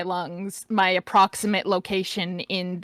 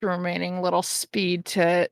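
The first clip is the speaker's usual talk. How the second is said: ordinary delivery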